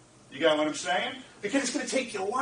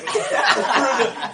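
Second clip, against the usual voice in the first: burst of laughter; 3 semitones higher, 8 dB louder than usual. Real, not acted. Laughing at own luxury trip.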